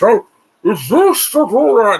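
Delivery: hammed-up mocking impression of Bane. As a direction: lampoon the villain with a squeaky, slow, loud voice. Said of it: "the Bane voice is laughable".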